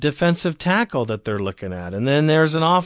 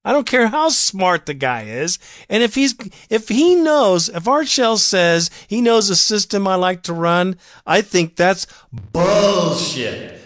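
heated rant; 6 semitones higher than usual. Real, not acted. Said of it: "I'm sorry I got emotional".

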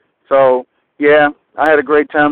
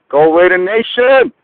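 higher, rising fan shout; rallying cheer; 5 semitones higher, 4 dB louder than usual. Real, not acted. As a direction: sign off with a rousing team cheer. Caller's rallying sign-off cheer.

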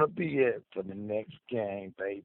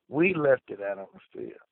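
ordinary talk

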